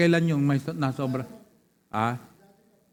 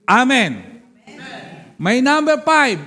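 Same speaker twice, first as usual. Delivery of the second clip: raised, high preacher's shout; loud rallying call; 4 semitones higher, 5 dB louder, slower than usual. Real, not acted. Preacher announces point five to congregation, loud rallying call.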